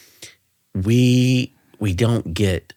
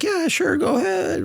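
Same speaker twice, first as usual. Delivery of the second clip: much higher, airy yeah sure go ahead; breezy casual reply. Retold or imitated. Cop answering his dispatcher, breezy and much higher. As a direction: toss it off lightly, suspecting nothing. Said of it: "I was like".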